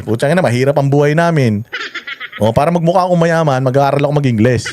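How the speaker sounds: ordinary talk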